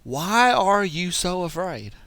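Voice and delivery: acted reproach to frightened followers; Jesus. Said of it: "And he said to them".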